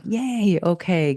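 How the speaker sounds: high bright relieved yay; chirpy yay. Retold or imitated. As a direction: squeak it out happily. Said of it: "I was like".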